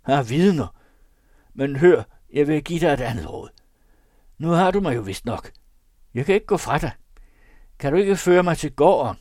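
high, loud, urgent poacher; bargaining poacher. Acted. (højlydt, indtrængende krybskytte)

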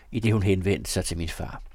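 plain reading voice

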